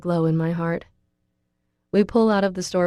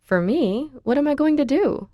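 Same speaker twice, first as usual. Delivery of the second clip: high, bewildered questions; lightly voiced bafflement; baffled; 8 semitones higher than usual. A narrator's reading. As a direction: voice jumps up in disbelief. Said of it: "I ask in bafflement".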